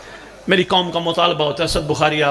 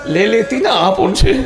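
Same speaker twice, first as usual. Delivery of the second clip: raised urging; 6 semitones higher, 6 dB louder than usual. Acted. Asking wife to take child.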